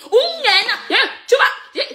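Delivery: high laughing jeer; laughing taunt. Real, not acted. (raillerie aiguë en riant)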